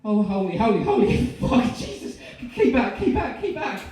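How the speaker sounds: high, loud terrified shouting; panicked yell. Acted. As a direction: scream it at the killer in horror. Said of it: "he espoused this phrase".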